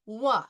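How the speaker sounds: wa as a clean French wa; French oi sound. Shown as a demonstration. Teacher models French oi as wa. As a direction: say wa like French moi. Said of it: "it is pronounced in French".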